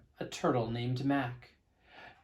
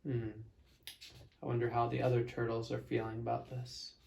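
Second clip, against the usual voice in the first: soft aside; 3 semitones lower, 7 dB quieter, faster than usual. Real, not acted. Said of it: "aside to the young listeners".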